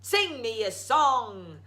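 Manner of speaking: slow, loud giant command; demanding giant. Acted. Giant ordering his harp to sing, slow loud demand. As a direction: order it like a bored tyrant. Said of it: "the giant demanded".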